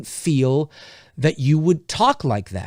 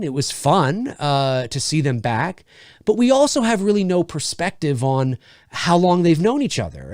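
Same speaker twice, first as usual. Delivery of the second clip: ordinary talk, nothing marked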